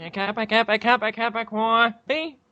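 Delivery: high mock New York "Coffee?" as "cwoffee"; teasing high New York accent. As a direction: ham up a New York "cawfee". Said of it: "why don't you say coffee".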